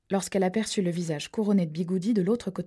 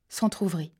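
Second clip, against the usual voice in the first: ordinary delivery, nothing marked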